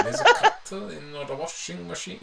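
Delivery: a friend's Norwegian lilt, giggling through; imitating Norwegian accent. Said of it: "he lived with a Norwegian".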